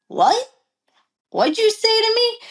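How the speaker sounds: high-pitched retold confrontation; indignant challenge. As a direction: challenge him right back, voice jumping up. Acted challenge back in retold quarrel.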